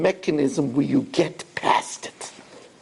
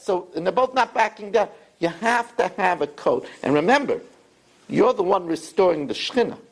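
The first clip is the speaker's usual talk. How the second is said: usual speaking voice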